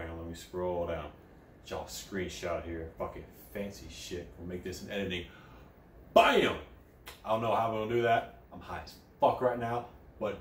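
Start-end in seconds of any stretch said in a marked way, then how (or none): 0.0-5.2 lower, softer muttering to himself; quiet aside. Real, not acted. Aside while scrolling. 6.1-6.6 high, loud punchy exclamation; sound effect shout. Pretend.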